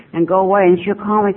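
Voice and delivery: ordinary talk, nothing marked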